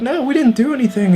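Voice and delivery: mock girls pleading innocence; high protesting girls. Girls denying, voiced high.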